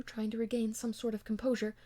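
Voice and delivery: ordinary delivery, nothing marked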